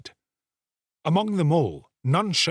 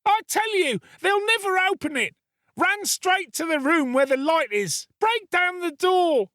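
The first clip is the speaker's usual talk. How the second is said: put-on boy screaming. Boy screams to the crowd to break in. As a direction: urge the crowd on with everything he has. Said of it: "screamed the boy".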